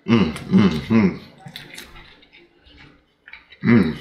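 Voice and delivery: humming while eating; talking between bites; mouth full, chewing. Real, not acted. Seen 2: Bites and chews handheld food between hums; mouth clearly full.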